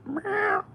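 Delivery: high meow with a little rumble; cat meow. Shown as a demonstration. Showing the cat's meow back to him, high-pitched. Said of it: "with a little rumble on the end".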